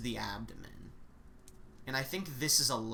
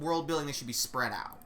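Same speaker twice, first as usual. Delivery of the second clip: ordinary delivery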